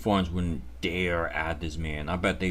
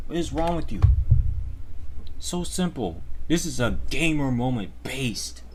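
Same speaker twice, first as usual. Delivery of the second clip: hammed-up sarcastic hype; a touch of fake enthusiasm; sarcastic rage baiter cheering on hate; mock praise; 4 semitones higher, 6 dB louder than usual. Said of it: "Clearly you guys knew I was fucking around".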